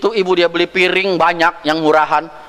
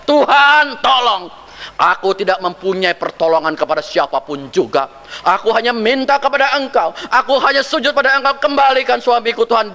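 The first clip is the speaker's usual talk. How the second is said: pleading prayer; 8 semitones higher than usual. Retold or imitated. Istri berdoa memohon kepada Tuhan.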